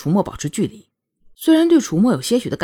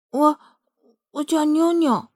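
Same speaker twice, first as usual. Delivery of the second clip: put-on high-pitched, hesitant little-girl voice; small shy girl; 8 semitones higher, slower than usual. A narrator's reading. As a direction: give your name bashfully, drawn out and high. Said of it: "妞妞的声音很小，看起来还很害羞". (害羞小女孩的细嫩嗓音)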